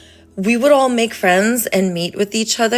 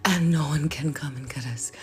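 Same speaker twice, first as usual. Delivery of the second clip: soft unvoiced whisper; 6 semitones lower, 8 dB quieter than usual. Assuring viewers nobody can get them, whispered. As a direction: whisper it like a safe secret.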